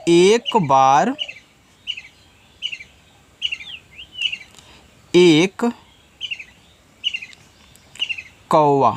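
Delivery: slow dictation. Shown as a demonstration.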